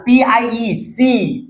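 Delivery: drawn out letter names; slow spelling. Shown as a demonstration. Teacher spelling piece for students, slowly. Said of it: "উচ্চারণ একই".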